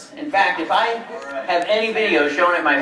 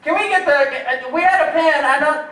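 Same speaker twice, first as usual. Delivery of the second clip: higher, a little flustered crew voice; lightly voiced puzzled crew member; mimicking confused crew; 10 semitones higher than usual. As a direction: play the crew hunting for a lost pen. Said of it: "they're like".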